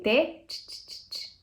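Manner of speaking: "t" repeated as short unvoiced taps; isolated T sound. Shown as a demonstration. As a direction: sound out "t, t, t, t" as bare consonants. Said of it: "você tem o T".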